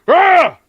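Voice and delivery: loud, high-pitched goblin yell; goblin war cry. Acted. Game master as the goblin chick charging in, war cry. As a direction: let out a fierce little goblin shout. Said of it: "curvy goblin chick's like".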